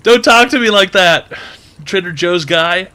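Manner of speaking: loud, high scolding; indignant scolding. Acted. Comedian scolds the Trader Joe's guy, indignant. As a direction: scold him, offended.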